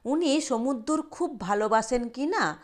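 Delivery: usual speaking voice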